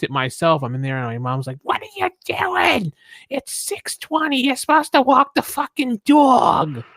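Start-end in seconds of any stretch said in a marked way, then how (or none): 1.6-6.8 high, drawn-out Long Island mom yelling; mock a nagging mom in a thick Long Island accent; slow, screechy-high Long Island mother impression. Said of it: "Long Island accent".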